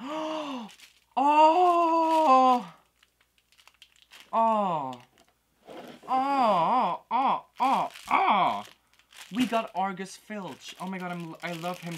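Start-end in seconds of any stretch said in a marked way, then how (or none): 0.0-8.6 gasping high, loud oh after oh; a run of shrieking ohs, much higher and louder; real excited squeals. Real, not acted. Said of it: "I'm, like, freaking out so much". 9.3-10.8 slow, loud, higher reveal of the name; excited slow reveal, louder; thrilled announcement. Real, not acted. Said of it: "freaking out so much".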